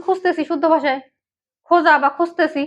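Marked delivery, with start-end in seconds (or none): none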